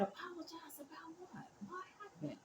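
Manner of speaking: hushed, high whisper of confusion; soft puzzled whisper. Retold or imitated. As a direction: whisper it, baffled. Puzzled reply to apology.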